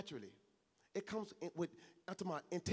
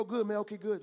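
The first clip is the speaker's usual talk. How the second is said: empty quick reply; 4 semitones higher, 7 dB louder than usual. Shown as a demonstration. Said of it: "That's so cliche and empty and vain".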